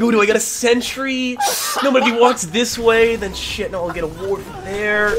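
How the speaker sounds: high, slow, giggly player voice; laughing mock indecision. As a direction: ham up a dithering player. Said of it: "Maybe he's thinking, like".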